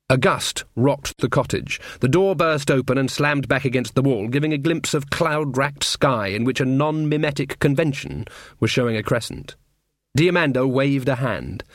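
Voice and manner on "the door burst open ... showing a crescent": usual speaking voice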